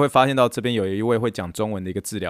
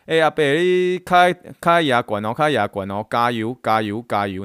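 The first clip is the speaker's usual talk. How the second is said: loud, slow-stretched encouragement to take big steps; coaxing cheer; 5 dB louder, slower than usual. Retold or imitated. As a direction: hype the old man up, step by step. Cheering on an elderly patient. (大声慢慢地鼓励阿伯跨大步)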